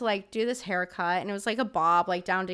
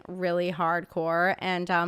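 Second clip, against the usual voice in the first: usual speaking voice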